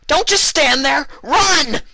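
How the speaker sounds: very high, loud frantic scream. A narrator's reading. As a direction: scream at her to run. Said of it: "Vinyl screamed".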